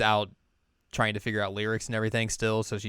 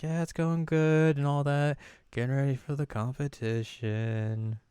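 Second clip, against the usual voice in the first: unconvincing reassurance; 5 semitones higher, slower than usual. Retold or imitated. Chisato's half-hearted answer about practice.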